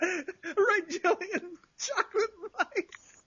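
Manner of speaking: high, slowing words full of giggles; talking through a real laughing fit; helpless laughter. Real, not acted. Joke told in laughing fit.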